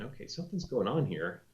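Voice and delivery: softly voiced private realisation; quiet retold aside; inner thought. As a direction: drop the volume as if thinking to yourself. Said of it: "I'm thinking".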